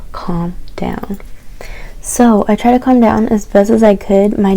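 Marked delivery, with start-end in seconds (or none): 0.1-1.2 retold near-whisper to oneself; hushed self-soothing. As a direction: softly imitate talking yourself down. Retells telling herself to calm down.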